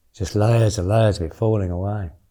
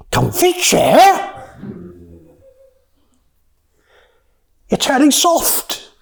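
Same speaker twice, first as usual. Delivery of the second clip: much higher, slow, scornful little voice; mocking inner voice; 12 semitones higher, slower than usual. Teacher voicing his inner critic at a cushioned chair, high and mocking. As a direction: sneer it in a high, drawn-out inner voice. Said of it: "this little voice goes".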